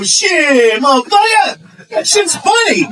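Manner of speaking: very high, exaggerated exclamation. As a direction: push it high in a hammed-up caricature.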